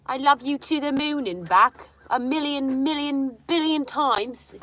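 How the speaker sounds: doting coo. Real, not acted. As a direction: gush over the dog lovingly.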